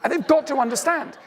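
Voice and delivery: imitating a partner near a meltdown; mock hysterical. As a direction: play the spouse losing it a bit. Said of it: "You're hysterical".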